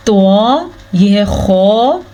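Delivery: खौ slow with rounded lips; rounded heavy kha. Shown as a demonstration. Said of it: "मुंह को गोल करें".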